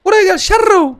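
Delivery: a tiny brat swearing; squeaky baby voice. Pretend. Gamer voices the demon baby cursing him, squeaky.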